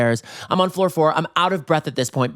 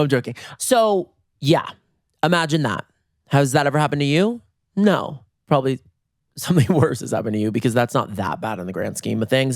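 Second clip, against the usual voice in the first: ordinary talk, nothing marked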